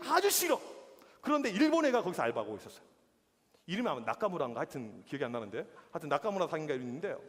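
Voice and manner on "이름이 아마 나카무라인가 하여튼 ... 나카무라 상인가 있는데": ordinary talk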